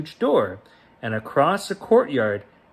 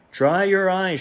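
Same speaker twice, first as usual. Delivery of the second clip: brightly higher and louder comfort; cheerful comfort; 7 semitones higher, 4 dB louder than usual. A narrator's reading. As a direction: chivvy him along kindly. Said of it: "she said".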